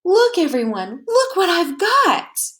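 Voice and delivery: high, eager call to the class; bursting to show her new things; a child who can't wait any longer; excited child showing off. A narrator's reading.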